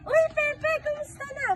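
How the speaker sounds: high, loud hail to a friend; excited greeting. Acted. Spotting friend Pepe passing through the barangay, excited greeting. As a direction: call out brightly to someone passing by.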